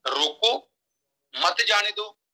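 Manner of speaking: long break between रोको and मत; pause after रोको. Shown as a demonstration. Speaker contrasting both meanings, pause after रोको. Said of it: "रोको, comma डाला था".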